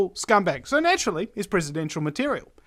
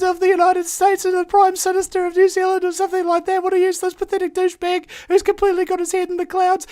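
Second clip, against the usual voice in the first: much higher, louder, fast jeering ridicule; mocking heckler; 11 semitones higher, 5 dB louder, faster than usual. Radio host acting out a heckler mocking the candidate, much higher jeering voice. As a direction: sneer at him like a laughing heckler in the street. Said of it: "point at them and go".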